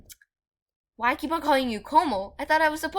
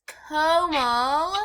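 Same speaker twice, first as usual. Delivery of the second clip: "Komal" stretched very slowly, rising at the end; pointed, long-drawn "Komal"; exaggerated name; 3 semitones higher, 4 dB louder than usual. Real, not acted. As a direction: draw out both syllables of "Komal". Pointedly saying the name right.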